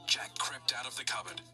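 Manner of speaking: ordinary delivery, nothing marked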